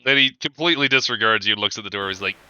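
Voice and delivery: ordinary talk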